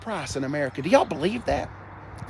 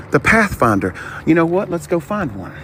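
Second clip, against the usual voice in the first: ordinary talk, nothing marked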